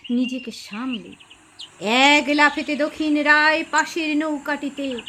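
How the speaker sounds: ordinary delivery, nothing marked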